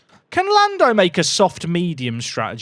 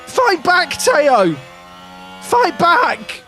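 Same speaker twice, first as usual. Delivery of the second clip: urgent shout; 10 semitones higher, much slower than usual. Real, not acted. Urging driver.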